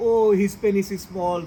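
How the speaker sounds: ordinary talk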